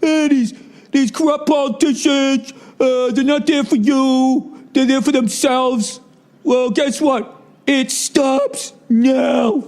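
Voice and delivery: high, slow mock Trump speech; Donald Trump voice. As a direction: ham up a tough campaign promise. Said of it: "He's sitting up there going".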